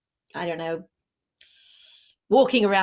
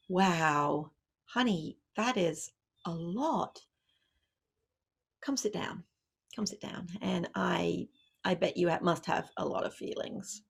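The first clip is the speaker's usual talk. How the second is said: calm soothing parent; 6 dB quieter than usual. Retold or imitated. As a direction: gently settle the upset child down. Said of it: "you walk over to her and you say".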